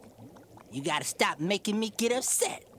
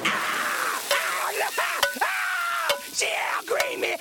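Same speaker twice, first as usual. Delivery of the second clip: pained screams; much slower than usual. Acted. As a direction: scream as if scalded. Husband scalded by hot stew.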